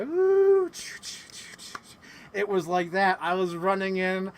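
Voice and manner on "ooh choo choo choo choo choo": Titanic imitation. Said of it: "like Titanic, at the end when you hear that".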